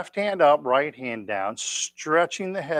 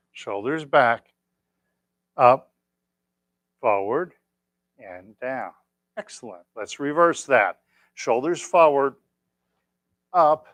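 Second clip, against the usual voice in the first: calm exercise cues; slower than usual. Acted. Instructor calling cues to class, calm and measured. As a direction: lead the class through each move.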